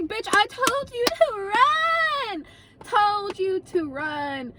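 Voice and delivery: high, drawn-out mock yell, easing down; mock indignant retort. Storyteller defends herself to abandoned friend, mock indignant retort. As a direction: stretch it out in exasperated protest.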